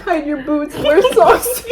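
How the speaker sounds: giggly retold advice; laughing mock warning. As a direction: crack up on the warning. Joking warning amid laughter.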